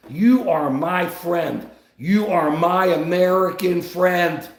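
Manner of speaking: ordinary delivery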